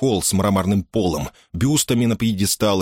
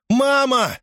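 put-on high-pitched child's call; crying out. A narrator's reading. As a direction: call out to mother on a long high note. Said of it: "крикнул он".

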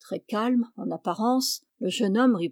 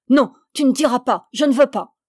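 a louder, panicked cry of refusal; terrified protest; 3 semitones higher, 6 dB louder than usual. A narrator's reading. The forester cries out to stop the young man, terrified and loud. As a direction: beg him to stop, in terror. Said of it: "Il bondit comme au contact d'un fer rouge".

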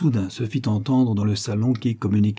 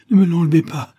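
loud, high terrified begging; panicked pleading, pitched way up; wailing, raised voice; 6 semitones higher, 5 dB louder than usual. A narrator's reading. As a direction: plead frantically for mercy. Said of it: "élevait la voix jusqu'à pousser des hurlements".

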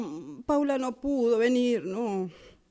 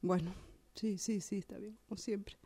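resigned whisper; 3 semitones lower, 13 dB quieter than usual. Acted. Resigned aside to herself.

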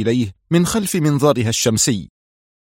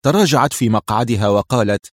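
usual speaking voice

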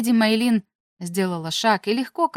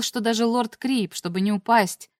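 ordinary delivery, nothing marked

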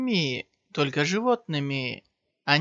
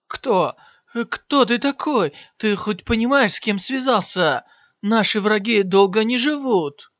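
shocked, higher and louder; 6 semitones higher, 7 dB louder than usual. A narrator's reading. Wounded warrior gasps at the stranger who killed his comrades, shocked. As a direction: warrior stunned by the stranger's strength, shouting in shock. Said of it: "с шоком сказал".

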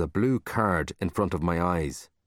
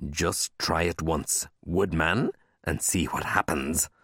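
slow, measured menace; 3 semitones lower, slower than usual. Acted. Librarian warning child off using her nickname, threatening. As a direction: dare them slowly, in a restrained voice.

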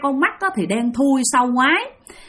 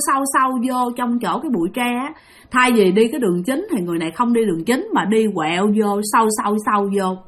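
ordinary delivery, nothing marked

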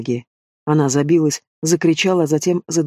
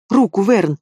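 a sharp, higher call to Vern; urgent cry; 3 semitones higher, slower than usual. A narrator's reading. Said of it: "воскликнула она".

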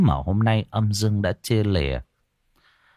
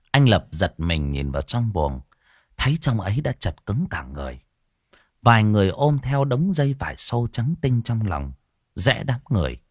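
plain reading voice

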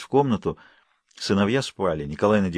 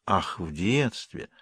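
mocking echo; 2 semitones lower, 7 dB quieter, much slower than usual. A narrator's reading. Mother mocking the answer.

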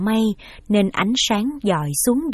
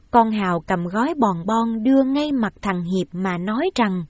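usual speaking voice

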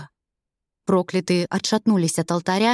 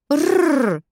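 angry growl; 5 semitones higher than usual. Acted. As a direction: growl through clenched fury. Furious ghost growls at them.

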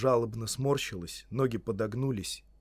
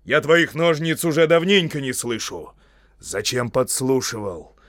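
loud, severe wizard questioning; forceful accusing demand; stern interrogation; 3 semitones higher, 8 dB louder than usual. Acted. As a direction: press a culprit for an answer. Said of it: "сурово оборвал его маг".

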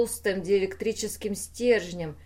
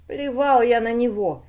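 louder, higher explosive retort; angry outburst; 4 semitones higher, 7 dB louder than usual. A narrator's reading. The constructor rejects calls to calm down, exploding. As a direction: burst out with indignation. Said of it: "взорвался конструктор".

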